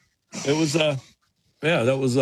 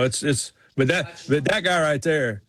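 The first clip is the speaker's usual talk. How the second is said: ordinary delivery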